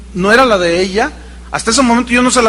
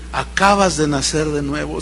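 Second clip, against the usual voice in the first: gentle; 6 semitones lower, 7 dB quieter than usual. Retold or imitated. Pastor's gentle words to her.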